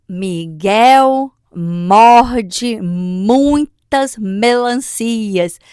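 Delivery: very slow, with drawn-out M sounds; alliteration demo. Shown as a demonstration. Child must spot the repeated sound, slow deliberate alliteration. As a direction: dwell on every word's opening sound. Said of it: "Qual é o som que aparece muito".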